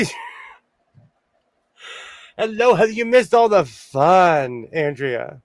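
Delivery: usual speaking voice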